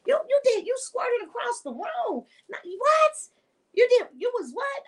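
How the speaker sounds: mock shock, voice shooting up high; stunned disbelief. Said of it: "I'm like".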